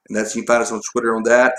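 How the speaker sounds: ordinary talk, nothing marked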